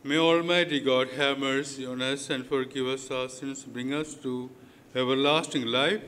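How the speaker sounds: ordinary talk, nothing marked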